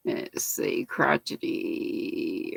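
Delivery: very soft, half-whispered while searching; absent murmur to self. Real, not acted. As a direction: murmur it under your breath as you scan. Scanning the chat to herself.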